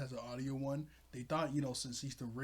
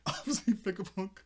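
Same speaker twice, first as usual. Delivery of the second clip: laughing; 7 semitones higher, 6 dB louder than usual. Real, not acted. Giggling about the limp body.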